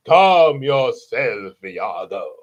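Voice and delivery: very slow, drawn-out words sinking low; villain calming sidekick. Retold or imitated. Villain soothes his squawking parrot Iago, slow sinking voice. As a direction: stretch it out, dropping deep at the end.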